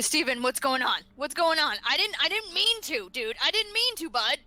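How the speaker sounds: high, urgent stammered pleading; distraught pleading. Acted. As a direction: plead desperately, pitch climbing. Kelder pleads with the dead boy.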